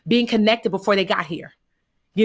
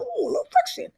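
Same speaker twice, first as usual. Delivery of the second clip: high, sing-song ooh of mock surprise; wry high-pitched understatement. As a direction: play it down in a much higher, cutesy voice. Mock-mild self-quote about rough year.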